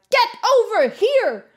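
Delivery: high, drawn-out yell to a dog; demonstrated dog-owner's holler; shouted command. Shown as a demonstration. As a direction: call the dog in firmly from across the yard. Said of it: "Or sometimes my dog, I'm like".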